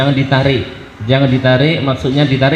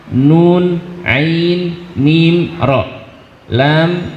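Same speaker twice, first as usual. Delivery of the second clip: usual speaking voice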